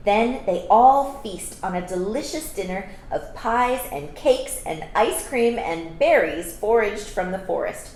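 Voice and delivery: ordinary delivery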